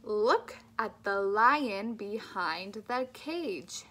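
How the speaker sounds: "love life" with slow, clear L; slow, careful speech-therapy model; slow clear L drill. Shown as a demonstration. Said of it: "Keep thinking about that tongue".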